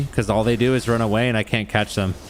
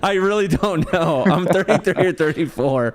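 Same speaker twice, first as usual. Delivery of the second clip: laughing, higher, at not knowing his age; 8 semitones higher than usual. Real, not acted. Gamer laughing to his friend that he doesn't know his age, laughing. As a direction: giggle through the admission.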